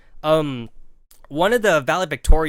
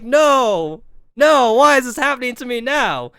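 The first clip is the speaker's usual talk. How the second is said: higher, much louder wail; panicked protest; 7 semitones higher, 9 dB louder than usual. Retold or imitated. Named on stage at graduation, loud panicked wail. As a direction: cry out in mock panic.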